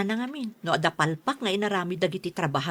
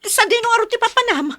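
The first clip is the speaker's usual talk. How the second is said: raised voice; 14 semitones higher, 5 dB louder than usual. Acted. Wife demands where husband goes.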